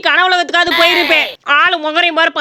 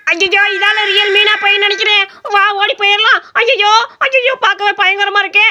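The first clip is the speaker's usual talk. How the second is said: higher-pitched cartoon alarm; panicked fleeing; 4 semitones higher than usual. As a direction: call out in fright, desperate to run. Panics and flees the ghost. (பதறி ஓடும் பயந்த குரல்)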